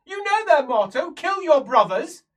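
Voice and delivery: loud, high-pitched outburst; acted shout. Game master voicing a quarrelling relative, loud high shout. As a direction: shout the family motto at full pitch.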